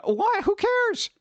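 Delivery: high, bright incredulous squeak; high mock-baffled why. Real, not acted. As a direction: toss it off like it's absurd. Shrugging off offense.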